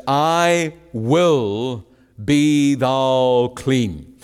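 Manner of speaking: very slow, every word weighed; emphatic quote. Shown as a demonstration. Preacher quoting Jesus to the congregation, slow and weighty. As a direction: lay down each word of I will be thou clean slowly. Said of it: "note the emphasis on what Jesus had spoken".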